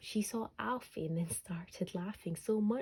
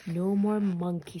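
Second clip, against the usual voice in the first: much louder, stern warning; firm mum; 10 dB louder than usual. A narrator's reading. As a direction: mum putting her foot down with her son. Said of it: "said Mum".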